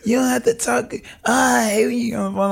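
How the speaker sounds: high, mock-complaining fiance; imitating fiance's complaint. Fiance teasing him from the background, mock complaint pitched high. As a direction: mimic her teasing from the background. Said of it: "she'll be in the background, like".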